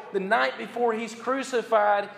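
ordinary talk

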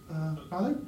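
hesitant housekeeper. Retold or imitated. Housekeeper hesitantly interrupts him crying.

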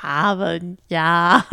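put-on slow Boston accent, dropped R. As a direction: flatten the A, Boston style. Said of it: "try to do your Boston accent".

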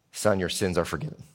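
low, soft and gentle; tender Jesus. Acted. Jesus to the man on the mat, low and tender. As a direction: speak it kindly as Jesus.

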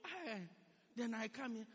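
ordinary delivery

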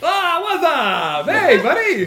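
loud, high, stretched-out hey buddy; excited bro greeting. Acted. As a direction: greet an old pal with big energy. GM voices Starp greeting friend.

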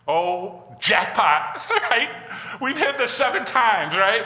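high, loud, laughing mock triumph; mock jackpot excitement. Preacher joking that seven apologies hit the jackpot, mock excitement. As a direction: crow it like a jokey jackpot win.

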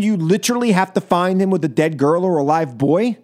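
normal talking voice

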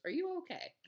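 mock British "are you okay"; light impression of a British singer's accent. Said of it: "in his adorable British accent".

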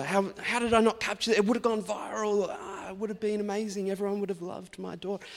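hammed-up outrage at missing the shot; self-mocking excited rant, voice shooting up; frantic disbelief. Retelling his own frantic reaction.

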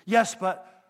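loud, abrupt mock protest; insistent objection. Street preacher ignores believer's answer, loudly insistent. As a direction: imitate a zealot butting straight back in.

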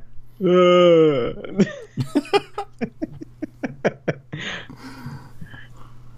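long laugh rising high. Real, not acted. Cohost laughing at his own zombie impression. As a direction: laugh it off at length.

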